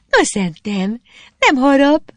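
high, teasing coaxing; laughing encouragement. A narrator's reading. Girl urging him to take a sip, amused encouragement. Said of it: "biztatott a lány nevetve".

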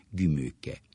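usual speaking voice

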